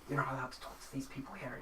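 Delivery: soft, fast undertone; hushed, hurried telling-off into an ear; whispered scolding. A narrator's reading. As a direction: scold under your breath, quickly. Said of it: "whispering into his ear".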